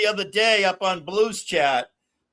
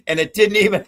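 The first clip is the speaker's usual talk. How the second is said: laughing; 3 semitones higher, 6 dB louder than usual. Real, not acted. Laughing at friend on camera.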